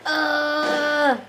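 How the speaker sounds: drawn-out uneasy groan; a goofy, uneasy groan; awkward groan. Acted. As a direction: let out a long awkward groan. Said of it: "awkward groaning".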